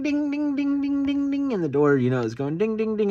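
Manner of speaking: high, sing-song chime ding ding; imitating a door chime. Telling how the truck's door alarm went off, high chime imitation. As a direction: mimic the key-in-door warning chime.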